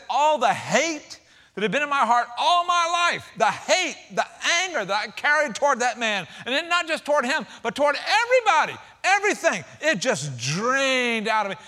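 from steady to a soaring, fervent peak; building sermon climax. Acted. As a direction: climb it like a preacher reaching the peak.

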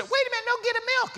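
very high little wife's voice. Retold or imitated. Tiny wife changes the order, high voice. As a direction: chirp it high, changing her mind.

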